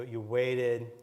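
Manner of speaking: ordinary talk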